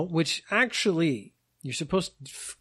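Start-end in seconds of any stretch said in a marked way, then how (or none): none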